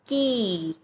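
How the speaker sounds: की with a long drawn-out ee; long vowel. Shown as a demonstration. Teacher showing children the big ee sign on क, long vowel. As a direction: stretch the vowel of की, letting it fall at the end. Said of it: "इसका उच्चारण थोड़ा लंबा और ऊंचा बोला जाएगा".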